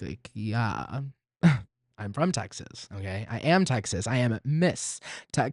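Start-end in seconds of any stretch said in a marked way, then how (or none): none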